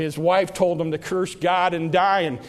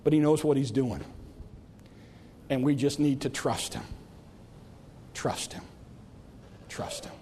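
softer and lower, fading to a whisper; 8 semitones lower, 11 dB quieter than usual. Real, not acted. Preacher urging trust, fading to a whisper. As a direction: let it die away to breath. Soft closing appeal, fading.

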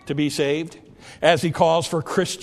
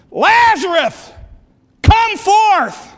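slow, high, commanding shout; Jesus calling out; 10 dB louder, much slower than usual. Acted. Jesus in the cemetery calling Lazarus, shouted call. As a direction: summon with a huge drawn-out yell. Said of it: "when Jesus called".

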